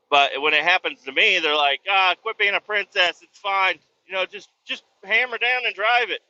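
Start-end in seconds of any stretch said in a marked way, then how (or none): 1.9-6.2 high-pitched mocking imitation; dismissive. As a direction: mimic them brushing off a complaint. Mocking how they dismiss his complaint.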